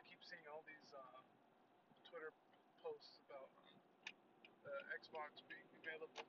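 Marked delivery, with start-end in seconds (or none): none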